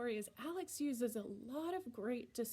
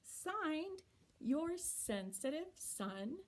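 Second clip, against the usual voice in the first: higher, animated read-aloud voice; story reading to children; 4 semitones higher than usual. Acted. Teacher reading a child's letter aloud to her class, animated. Reads the picture book aloud.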